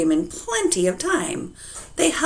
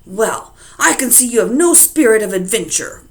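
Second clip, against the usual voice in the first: louder, raised grumpy jab; 4 semitones higher, 7 dB louder than usual. Acted. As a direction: grump at his timid wife. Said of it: "grumped Mister Wagon Wheel".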